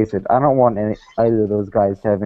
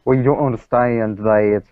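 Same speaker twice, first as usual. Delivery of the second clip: put-on Southern accent. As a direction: preach it like a hammed-up Southern creationist. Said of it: "You should work on your accent".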